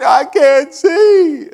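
high, loud, slow wail; drawn-out panic. Retold or imitated. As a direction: moan it out, blinded. Still blinded by paint.

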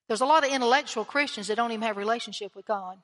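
ordinary delivery

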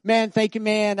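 effusive, retold gratitude; thankful youth. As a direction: imitate a relieved teen thanking his rescuer. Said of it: "so thankful".

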